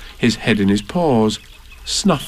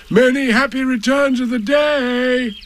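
higher, cheerful bear calling across a river; 13 semitones higher than usual. A narrator's reading. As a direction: call it out across the water. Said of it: "called out Pooh".

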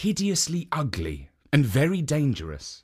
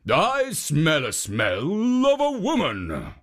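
sniffing ogre; 4 semitones higher, slower than usual. A narrator's reading. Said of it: "sniffing the air again".

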